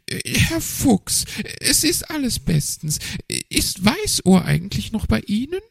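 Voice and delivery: high, nervous magpie voice. A narrator's reading. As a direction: play the magpie up high and hesitant. Narrator voices magpie Frau Elster.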